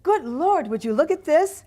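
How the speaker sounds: high, loud startled cry; shriek. Acted. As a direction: shriek in shock at the rotten grapes. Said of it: "Then she shrieked".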